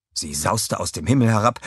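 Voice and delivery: ordinary delivery, nothing marked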